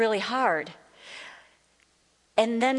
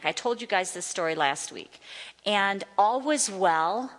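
usual speaking voice

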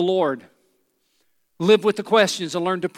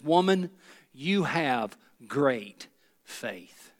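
tender; 5 semitones lower, 5 dB quieter, much slower than usual. Acted. Preacher voicing Jesus, slow and soft.

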